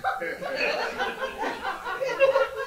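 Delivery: shrieking laugh, high; high real laughter. Real, not acted. Preacher laughs at her own delivery-room story.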